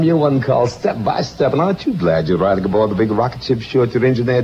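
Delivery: ordinary talk, nothing marked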